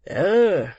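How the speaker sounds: loud, swooping "oh" of distaste; shuddering "oh". Acted. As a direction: give a drawn-out shudder of disgust. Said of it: "with a slight shudder".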